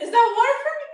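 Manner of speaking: high, loud, laughing "Is that water for me?"; laughing retelling. Retold or imitated. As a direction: laugh through the question. Repeating question while laughing.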